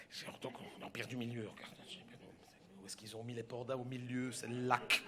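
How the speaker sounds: low, soft muttering over a map; puzzled murmur. Retold or imitated. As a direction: think aloud, hunting for the spot. Retold puzzling over the park map. (marmonnement perplexe, bas)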